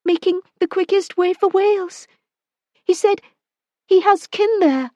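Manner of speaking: woman gives way under his grip, pleading; frightened woman. Acted. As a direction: plead with him, afraid. Woman confessing.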